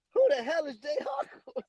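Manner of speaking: high incredulous wife voice; mock outraged wife; imitating wife. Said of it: "watch my wife again".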